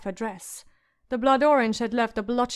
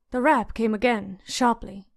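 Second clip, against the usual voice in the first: ordinary delivery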